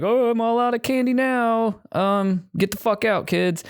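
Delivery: high cartoonish Santa telling kids to get lost; mock Santa voice. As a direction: squeak it out like a fed-up mall Santa. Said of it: "he's basically like".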